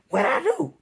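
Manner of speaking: high, loud mock-innocent "What I do?"; whiny bully voice. Mocking the bully kid's fake innocence, high and whiny. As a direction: play dumb in a high whine. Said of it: "he says it in this voice".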